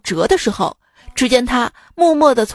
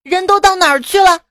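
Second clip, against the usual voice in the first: higher, louder furious shout; angry teacher; 6 semitones higher, 4 dB louder than usual. Acted. Teacher shouting at the empty classroom, furious. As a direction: bellow at the half-empty class. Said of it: "大怒道".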